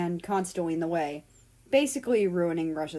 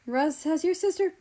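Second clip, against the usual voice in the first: higher voiced dad, a touch of a different man; lightly voiced father; 9 semitones higher than usual. Father checking on son about sister, lightly voiced higher.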